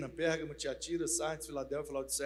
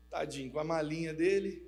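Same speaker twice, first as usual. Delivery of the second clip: usual speaking voice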